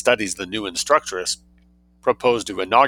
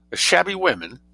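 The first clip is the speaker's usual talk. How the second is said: surprised; 10 semitones higher than usual. A narrator's reading. Startled echo of the child.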